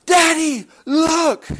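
small daughter calling her father to come look; little girl crying out. Retold or imitated. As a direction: squeal it like a small child showing her father. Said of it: "she cries out".

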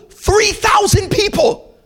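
high, ringing preacher's cry; preaching peak. Real, not acted. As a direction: lift it up to the whole church.

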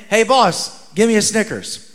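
ordinary delivery

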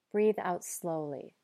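ordinary talk

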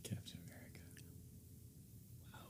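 whispered, breathless answer. Real, not acted. Whispered answer to quick question.

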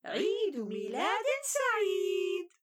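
cheering. Acted. Family cheers birthday.

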